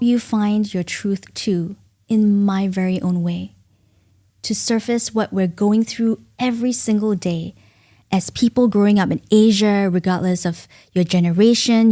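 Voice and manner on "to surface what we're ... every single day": usual speaking voice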